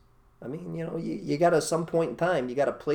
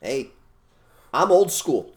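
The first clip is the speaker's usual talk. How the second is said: usual speaking voice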